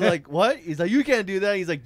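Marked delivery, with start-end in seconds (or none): 0.3-0.6 high, loud disbelieving what; loud stunned what. Retold or imitated. Driver shocked at passenger being ditched, loud disbelief. Shocked reaction in retold scene. 0.9-1.6 higher, louder can't-believe-it protest. Retold or imitated. Driver objecting to ditching the drunk guy, loud protest.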